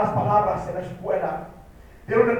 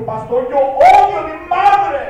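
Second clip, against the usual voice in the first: louder, forceful repeat of the confession; 9 semitones higher, 12 dB louder than usual. Retold or imitated. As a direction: say it again, insisting. Boy repeats confession, pastor asked "¿qué?. (repetido más fuerte, con insistencia)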